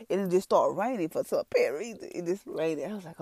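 usual speaking voice

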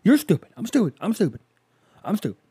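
high barked drill chant fading down; drill sergeant. Retold or imitated. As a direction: bark like a drill instructor, trailing off. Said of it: "Full Metal Jacket".

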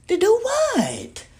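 high, slow disbelieving question; incredulous. Real, not acted. Reacting to the Illuminati offer, drawn-out disbelief. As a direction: stretch it out in disbelief.

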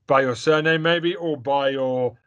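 usual speaking voice

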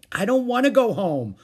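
high, loud whining protest; childish protest. A narrator's reading. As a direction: protest like a reluctant small boy. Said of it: "protested Howie".

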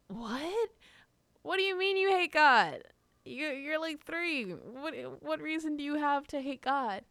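high, loud mock-appalled questioning; outraged disbelief. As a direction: act out a scandalized older sibling. Acted outrage at little sister's claim.

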